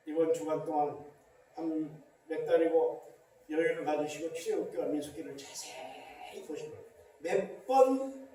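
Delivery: ordinary talk, nothing marked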